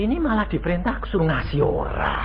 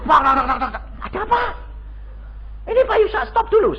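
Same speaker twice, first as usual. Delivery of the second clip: calling out; 10 semitones higher, faster than usual. Retold or imitated. Woman chasing the car.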